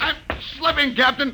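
high-pitched, strained plea; you're losing your grip, beg for help; panicked call. Acted. Briggs hanging in the shaft, pleading with the captain in panic.

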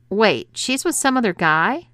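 rising in disbelief. A narrator's reading. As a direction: push the pitch up in alarm. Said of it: "his voice rising".

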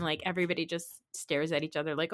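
usual speaking voice

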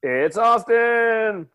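sing-out self-introduction; announcer-style intro. Pretend. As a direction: stretch it out like a hyped introduction. Cast introductions, showy name call.

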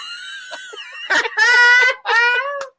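high loud cackling laugh; shrieking laugh. Real, not acted. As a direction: burst into shrill laughter. Huge laugh at joke.

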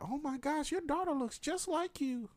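squeaky high gushing stranger; high-pitched gushing. Retold or imitated. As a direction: gush in a very high voice. Strangers mistake boy for girl.

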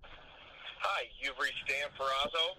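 recorded phone greeting; tinny voicemail message. Real, not acted.

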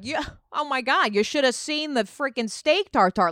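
a hint of open-mic delivery; pretend stand-up comic. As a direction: riff loudly like an amateur comic. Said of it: "a stand-up open mic night".

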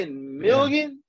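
1 slow incredulous. Real, not acted. Repeating in disbelief.

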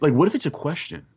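ordinary talk, nothing marked

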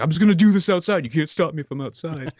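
childish defiant tantrum, lightly hammed; mock tantrum. Mock tantrum at mall security. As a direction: sulk it like a kid throwing a fit. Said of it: "in a tantrum way".

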